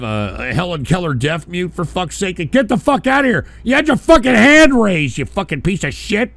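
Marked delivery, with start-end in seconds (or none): 2.5-6.3 high, loud irritated yell; exasperated, raised-voice scolding; angry dismissal. Real, not acted. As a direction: throw a silent caller off the line. Host throws silent caller off.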